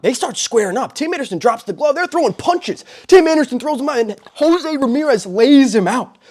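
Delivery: excited play-by-play. Real, not acted. Host calling the brawl for viewers, building to a shout.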